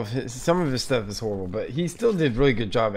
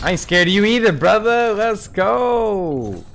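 loud, high wrestler-style trash talk; hyped taunt; 10 semitones higher, 8 dB louder, slower than usual. Pretend. Taunting the enemy player, loud hyped wrestler shout. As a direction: bellow it like a pro wrestler cutting a promo. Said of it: "repeated taunt, louder".

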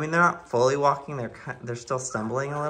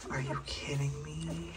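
whisper=48; 3 semitones higher, 12 dB quieter than usual. Real, not acted. Whispering over sleeping puppies.

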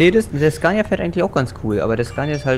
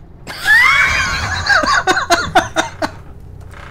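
high, shouted laugh. Real, not acted. Bursts out laughing.

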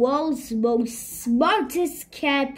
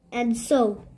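plain reading voice

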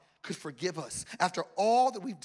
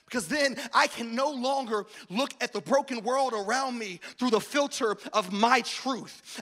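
ordinary talk

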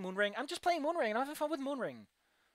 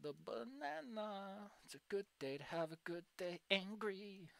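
mumbling; 15 dB quieter, slower than usual. Real, not acted. Murmuring meme phrases.

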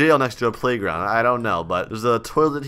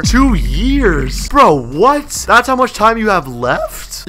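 high, loud incredulous questioning; shocked disbelief; 5 semitones higher, 4 dB louder, much slower than usual. Pretend. Inmate hearing Randall's two years left, shocked. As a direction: let the pitch jump in astonishment.

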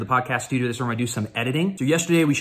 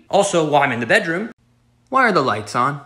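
usual speaking voice

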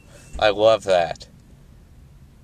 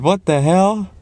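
higher and louder, baffled; loud baffled what the hell; exasperated; 9 semitones higher, 4 dB louder, slower than usual. Real, not acted. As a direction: throw it up in the air. Reacting to the awkward hug.

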